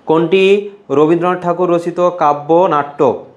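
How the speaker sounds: slow, deliberate reread, leaning on কোনটি; teacher repeating slowly for the class. Shown as a demonstration. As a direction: spell the question out slowly for students. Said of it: "বলো".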